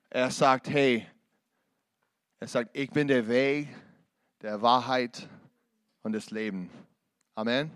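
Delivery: lautes, eindringliches Predigen, Silben einzeln betont; full-force preaching. Acted. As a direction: predige mit voller Kraft und Überzeugung. Preaching loudly to crowd. (Prediger ruft der Gemeinde zu, laut und eindringlich)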